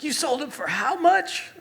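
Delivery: high, loud mock outrage; aghast friend, imitated. Australian friend learns the sneakers are sold, aghast. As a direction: squawk it in disbelief.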